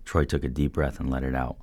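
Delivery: plain reading voice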